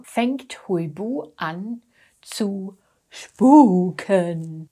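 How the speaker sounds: ordinary talk, nothing marked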